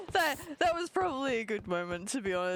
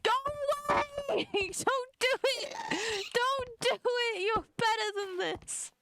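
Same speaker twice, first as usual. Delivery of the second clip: high frantic begging; panicked pleading; 13 semitones higher, 3 dB louder than usual. Real, not acted. Begging monsters in game, panicking.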